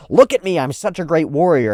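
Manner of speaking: loud, high mock scream. Olmar demanding attention, a loud high mock scream. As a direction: scream it for everyone to hear. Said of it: "I'm gonna scream with all my might".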